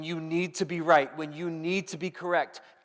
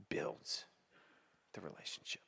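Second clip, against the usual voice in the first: whispered, unvoiced "builds the relationship"; whispered point; 11 semitones lower, 15 dB quieter than usual. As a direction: drop to a whisper for effect. Sermon point drops to whisper.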